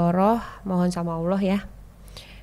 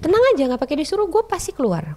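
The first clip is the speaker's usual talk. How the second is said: fast, louder, cocky comeback; wife defiantly telling husband she'll leave; defiant retort; 7 semitones higher, 3 dB louder than usual. Retold or imitated. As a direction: fire it back at him, unafraid. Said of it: "Aku bilang gitu".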